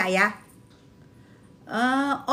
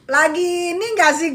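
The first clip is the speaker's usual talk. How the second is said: usual speaking voice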